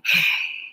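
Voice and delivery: a sudden shocked intake of breath; sharp gasp. Real, not acted. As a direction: gasp at the bad news. Reacting to viewer's bad news.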